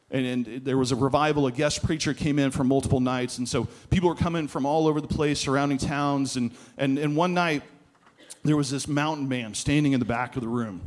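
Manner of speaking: usual speaking voice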